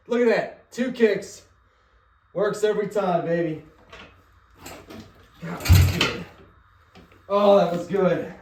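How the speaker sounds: loud, high, thrilled cheering; excited shouting. Real, not acted. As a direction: cheer like the engine just roared. Bike just started after kicks.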